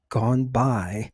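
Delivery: normal talking voice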